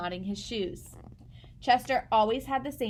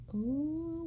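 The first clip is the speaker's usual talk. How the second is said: soft gasp; 5 semitones higher, 4 dB quieter than usual. Real, not acted. Reader gasps at cat.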